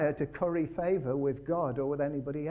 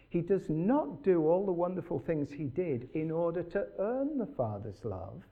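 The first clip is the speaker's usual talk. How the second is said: normal talking voice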